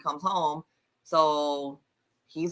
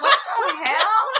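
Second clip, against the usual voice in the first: laughing disbelief; 10 semitones higher, 8 dB louder than usual. Retold or imitated. Shocked by the orgy scene.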